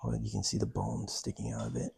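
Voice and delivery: whispering. Real, not acted. Whispering in a cave.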